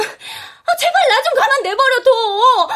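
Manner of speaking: fed up, push mom away; exasperated outburst. Acted. Daughter snaps back at mom.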